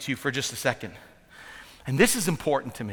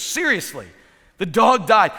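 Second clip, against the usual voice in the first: loud, higher disbelief at the news; shocked wife; 4 semitones higher, 6 dB louder than usual. Retold or imitated. Wife on the phone hearing the dog died, loud disbelief. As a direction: cry out in disbelief. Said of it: "she just goes".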